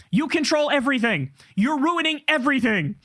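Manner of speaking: ordinary talk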